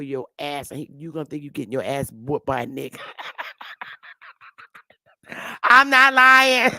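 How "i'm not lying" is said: loud laughing, pushing the words out through the laughter; laughing insistence. Real, not acted. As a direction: laugh it out, insisting it's true. Insists while laughing hard.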